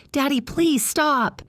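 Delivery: high, slow frightened whimper; whimpering child. Acted. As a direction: plead like a scared little girl. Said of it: "she whimpered".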